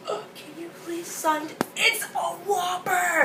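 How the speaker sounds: high squealing teary fan voice; starstruck fan. Retold or imitated. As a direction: gush like a hysterical fan. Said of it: "and then she's like".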